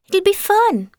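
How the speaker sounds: ordinary delivery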